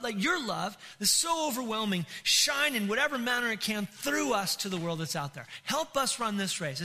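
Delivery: fervent, rousing sermon delivery; impassioned preaching. Real, not acted. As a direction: drive each phrase home to a congregation. Preaches at full force.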